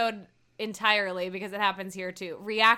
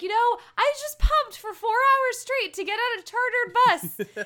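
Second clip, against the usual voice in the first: upset Jocelyn quote; 12 semitones higher, 5 dB louder than usual. Retold or imitated. Voicing Jocelyn's upset complaint.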